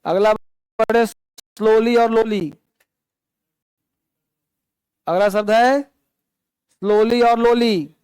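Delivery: slow, spaced-out words; slow dictation. Shown as a demonstration. Teacher to class announcing the next word, slowly dictated. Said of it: "teacher dictating new word".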